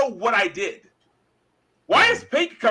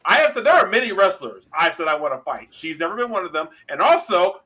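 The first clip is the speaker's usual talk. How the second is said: ordinary talk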